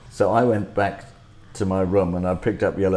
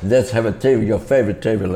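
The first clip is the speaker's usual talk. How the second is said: hammed-up French host greeting, raised voice; effusive restaurant host; 2 semitones higher, 5 dB louder than usual. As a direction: gush a big welcome to the guest. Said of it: "people would come into the restaurant".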